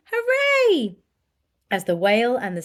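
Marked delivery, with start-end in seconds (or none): 0.1-1.0 much higher, loud cheer. Acted. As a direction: cheer with the crowd. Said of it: "villagers shouting".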